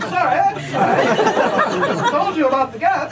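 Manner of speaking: high, loud, laughing sorry; laughing mock apology. Comic voicing the train apologizing to a dragged passenger, laughing. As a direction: apologize while cracking up. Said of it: "it's like".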